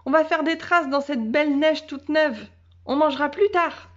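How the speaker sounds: high, eager call; little girl calling. A narrator's reading. Little girl urging her dog out into the snow. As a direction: call the dog to come play.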